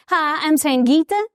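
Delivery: little girl's East Texas twang, imitated; East Texas accent. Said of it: "that's the way we talk in East Texas".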